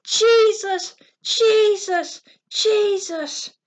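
high, loud drawn-out shouts; screamed cry. A narrator's reading. Three girls calling to Jesus in the crowd, screaming. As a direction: cry the name out with all your might. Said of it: "screamed and cried with all their might".

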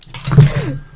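shrieking laugh; high burst of laughter. Real, not acted. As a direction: cackle helplessly. Friend's big win, losing it.

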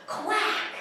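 high quick duck quack. Acted. Reader voices the duck at the library, high quack.

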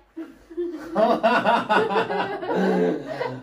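long high peal of laughter; helpless, drawn-out giggling fit; laughing. Real, not acted. Stunt partner collapsing mid-attempt, long high laugh.

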